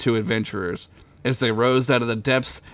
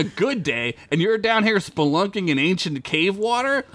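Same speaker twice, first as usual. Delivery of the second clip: scolding character voice; 7 semitones higher than usual. Acted.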